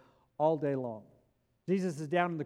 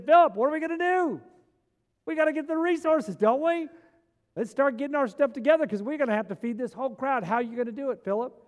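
lively, higher voicing of Jesus questioning Philip; Jesus testing his disciple, a lively higher voice; 7 semitones higher, 4 dB louder than usual. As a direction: put the question to Philip, pressing him. Preacher voices Jesus asking Philip.

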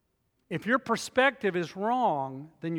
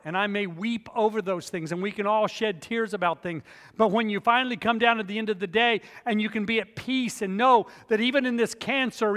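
ordinary talk

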